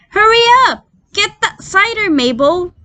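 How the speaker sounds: high, loud call from upstairs. A narrator's reading. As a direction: shout up the stairs to Mabel. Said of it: "a woman's voice calling out".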